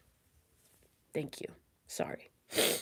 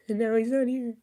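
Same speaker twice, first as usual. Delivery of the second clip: louder, grieving outburst; tearful; 5 semitones higher, 6 dB louder than usual. Real, not acted. Streamer mourning a brother who should be here, tearful. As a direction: push the words out through real tears. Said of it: "Crying on YouTube is wild".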